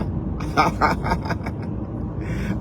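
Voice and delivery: rising airy giggle without voice; breathy laugh. Real, not acted. Streamer teasing a scared rival, airy rising giggle. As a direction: let a silent, breathy giggle climb.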